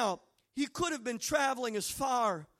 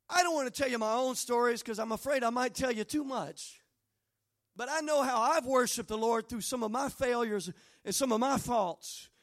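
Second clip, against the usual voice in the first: ordinary talk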